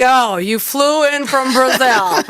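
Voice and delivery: slow, drawn-out fake pity, imitating the coach; teasing echo stretched out to expose the complaint; mock sympathy. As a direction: repeat it back like it's a tragedy, slowly. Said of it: "He's like".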